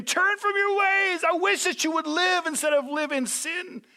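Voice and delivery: God voiced as a desperate shout; pleading shout. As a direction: plead with the wicked to turn. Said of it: "he just starts screaming".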